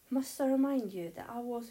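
loud, high indignant comeback; heated retort. A narrator's reading. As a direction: throw the accusation straight back.

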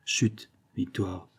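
slow, soft hush. A narrator's reading. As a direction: shush her sister quietly. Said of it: "interrompit la sœur aînée". (chut lent et doux)

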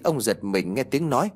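ordinary delivery, nothing marked

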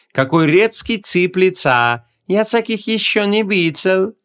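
high, slow, sweet teasing voice; sugary sorcerer. A narrator's reading. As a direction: coo it slowly in a sugary high voice. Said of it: "с очень сладеньким голосом". (сладенький высокий протяжный голос)

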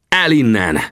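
barked order. A narrator's reading. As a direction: order him off forcefully, without a full scream. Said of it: "rivaltam rá".

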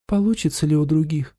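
a hint of doubt and hope; doubtful hopeful. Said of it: "он услышал сомнение, надежду".

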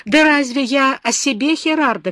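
high, loud desperate cry to Herardo. A narrator's reading. Beba cries out to comforting Herardo, loud and high. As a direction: cry out to him, pleading. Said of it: "крикнула она".

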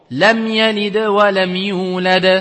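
slow recitation, the mim of lam said clearly with only a light ghunna; tajwid recitation. Shown as a demonstration. Teacher recites the example verse, slow and clear. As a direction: recite lam yalid wa lam yulad slowly, passing lightly over the mim. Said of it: "Tu passes directement".

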